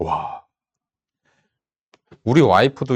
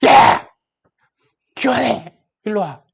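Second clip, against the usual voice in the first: loud bark, then high-pitched scolding; 스트레스 받은 엄마; 9 semitones higher, 6 dB louder, slower than usual. Retold or imitated. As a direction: imitate a stressed-out mom yelling at the kids. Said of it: "스트레스 많이 받아서". (아이들에게 버럭 소리치는 엄마 흉내)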